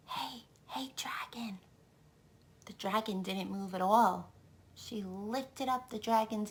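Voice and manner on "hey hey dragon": soft whisper. Acted. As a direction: whisper so the dragon stays asleep. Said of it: "Elizabeth whispered very softly".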